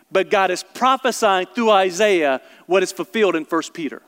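usual speaking voice

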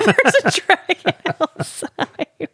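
high, slow words through laughter; laughing echo. Real, not acted. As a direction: repeat it while cracking up. Echoing partner's deadpan joke, laughing.

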